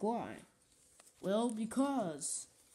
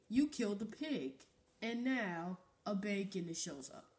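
normal talking voice

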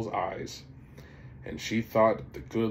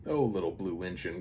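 plain reading voice

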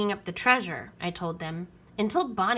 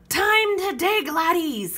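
high, loud pirate order; Braidbeard commanding; 9 semitones higher, 6 dB louder than usual. A narrator's reading. Pirate captain ordering crew to dig, loud command. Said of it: "commanded Braidbeard".

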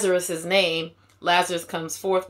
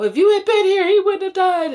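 much higher, much louder hysterical cry; hysterical wail; 13 semitones higher, 8 dB louder than usual. Acted. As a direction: wail it out like a grieving sister. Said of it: "like I said, hysterical".

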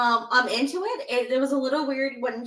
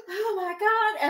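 mock shock; 9 semitones higher, slower than usual. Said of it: "So he was just like".

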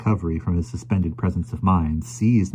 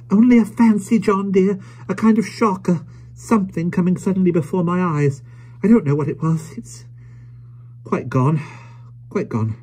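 very high, agitated woman's voice dying away; shaken little wife; 12 semitones higher, 4 dB louder than usual. Acted. Wife explaining her shock to John, high agitated voice. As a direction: reassure him hurriedly, then fade.

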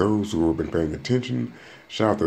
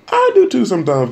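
high squeak; 13 semitones higher, 8 dB louder than usual. Real, not acted. Sudden squeak of admission.

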